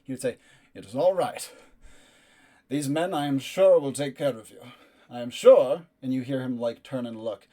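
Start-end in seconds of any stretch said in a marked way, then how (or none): 0.7-5.9 louder, carrying reassurance to the men on the walls; loud knight calling up to the guards; loud, not tender. Acted. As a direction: call it up loudly so the soldiers hear. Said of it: "loudly enough to be heard. It's not tender and soft".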